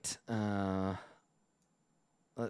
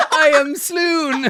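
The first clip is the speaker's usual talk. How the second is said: high, slow, laughing swoon; hammed-up goblin swoon through laughter; 8 dB louder, much slower than usual. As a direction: squeak it out like a fainting goblin.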